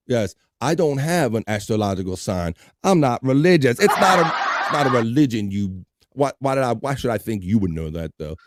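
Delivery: ordinary talk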